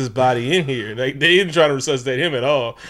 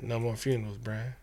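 soft, slow, laughing mock lament; mock sad murmur; 3 semitones lower, 10 dB quieter, slower than usual. Imagined kid mourning to Brian, a hushed slow lament.

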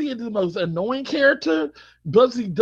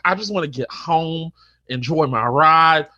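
usual speaking voice

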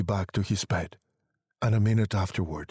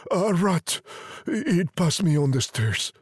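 higher, nervous, faltering reply; shaky old man; 9 semitones higher than usual. A narrator's reading. Old man reassuring his listening wife, shakily. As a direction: explain it away in a rattled, raised voice. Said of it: "said the old man shakily".

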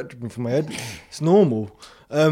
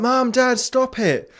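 put-on higher child's call; child pleading; 8 semitones higher, much slower than usual. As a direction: call out to the parents to stop. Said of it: "So I went".

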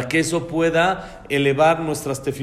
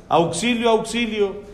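mild mock cry; 6 semitones higher than usual. Princess facing bandits, mild mock cry for help. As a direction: imitate a cry for help without really shouting. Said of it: "ella empezó a gritar".

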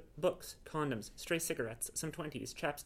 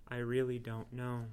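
wistful; slower than usual. Acted. Wistful at snowy window.

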